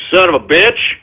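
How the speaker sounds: ordinary talk, nothing marked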